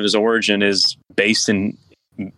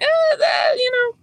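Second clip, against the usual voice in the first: sudden squeak way up high; squeaky high voice. Real, not acted. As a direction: jump to a tiny squeaky pitch. Nitpick, voice jumps up.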